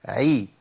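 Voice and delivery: عي with a throat ayn and thin ee vowel; thinned ayn-kasra. Shown as a demonstration. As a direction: start عي in the throat, spread it into a thin ee. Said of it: "ترقيقها أكبر". (عي مكسورة بترقيق أكبر)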